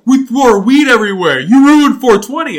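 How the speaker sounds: furious stoner shouting at me; angry stoner yell. Retold or imitated.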